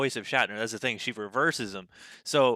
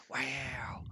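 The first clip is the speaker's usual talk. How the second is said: whispered, breathy "Wow."; imitating a soft Owen Wilson wow; Owen Wilson impression; 2 semitones higher, 10 dB quieter than usual. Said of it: "she was like".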